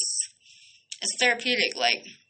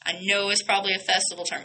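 normal talking voice

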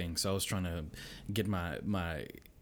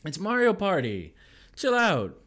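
slow, drawn-out, rising to louder and higher; exasperated dad; 5 semitones higher, 6 dB louder, slower than usual. Retold or imitated. Dad to crying kids, exasperated building up. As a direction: stretch it out, then snap up. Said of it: "And I'm like".

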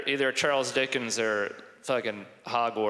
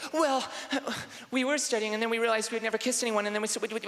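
put-on high, hurried girly explanation; rushed, put-on female voice; college girl; 7 semitones higher, much faster than usual. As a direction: gush it out as a girl. Imagined girl explains to him.

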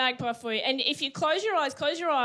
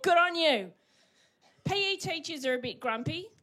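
ordinary talk

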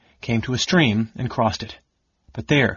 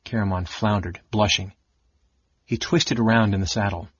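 plain reading voice

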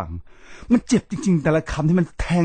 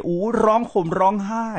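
mocking; 7 semitones higher than usual. Mocking mourners' fake grief.

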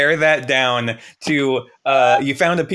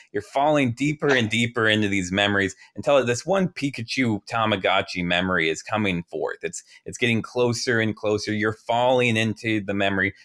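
hypnotist easing you into memory; guided meditation; 3 semitones lower than usual. Acted. Leads a guided memory visualization.

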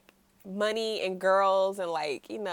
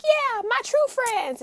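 whiny son; 14 semitones higher, slower than usual. Retold or imitated. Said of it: "he's like".

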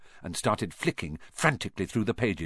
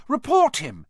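high, loud and fast urging; 6 dB louder than usual. A narrator's reading. Hermione urges Neville to report Malfoy, urgently. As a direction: push him to go, urgently. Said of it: "Hermione urged Neville".

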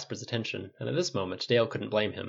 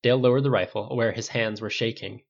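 usual speaking voice

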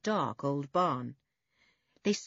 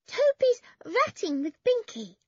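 put-on very high, loud little-child voice; small boy; 6 dB louder than usual. A narrator's reading. Small boy says where Toby is, small child's voice. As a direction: announce it as a five-year-old. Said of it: "said the boy".

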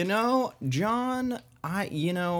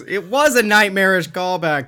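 ordinary talk, nothing marked

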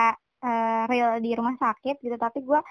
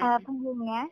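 ordinary talk

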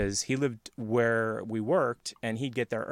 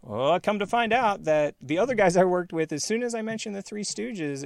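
usual speaking voice

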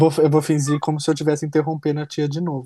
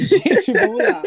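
after laughing hard; 12 semitones higher, 4 dB louder, slower than usual. Real, not acted. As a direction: still laughing, higher. Laughing after homicide joke.

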